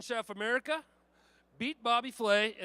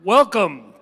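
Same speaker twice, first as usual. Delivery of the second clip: big booming welcome to the crowd; host's loud opening welcome; 3 semitones lower, 15 dB louder than usual. Acted. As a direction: call it out to the whole hall. MC opens the event.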